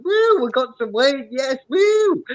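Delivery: imitating the excited mate; excited whoops. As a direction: whoop like you just scored. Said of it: "My mate's jumping up and down going".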